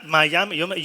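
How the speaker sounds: normal talking voice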